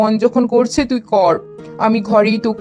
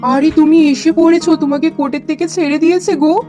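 surprised wife. Acted. Wife greets freed husband.

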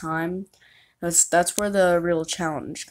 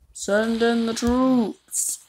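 slow, higher drawn-out order; commanding voice; 5 semitones higher, much slower than usual. Pretend. Ordering the troops forward in a slow commander voice. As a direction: call it out like a general.

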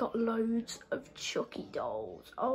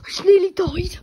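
higher, louder half-sobbing panic; panicked crying; 8 semitones higher, 13 dB louder than usual. Real, not acted. Player scrambling under the bed, panicked crying. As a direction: wail it out in fright.

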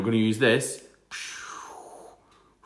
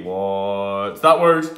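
slow teasing question; 3 semitones lower, 5 dB louder, slower than usual. Pretend. Teacher teases slowly.

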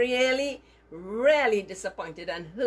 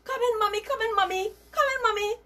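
put-on much higher, bright little-boy voice; child's reply; 13 semitones higher, 4 dB louder, faster than usual. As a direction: sing it out like an innocent small boy. Daryl answers his mother's call.

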